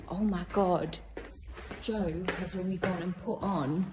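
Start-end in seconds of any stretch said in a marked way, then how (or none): none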